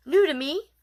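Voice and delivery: plain reading voice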